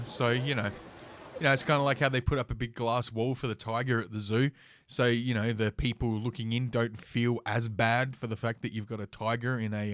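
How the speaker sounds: ordinary talk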